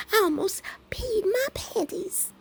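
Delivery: high squeal breaking into laughter; helpless laughter. Real, not acted. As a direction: squeeze the words out high while cracking up. Cracking up over the gift.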